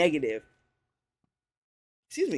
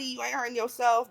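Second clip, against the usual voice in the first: usual speaking voice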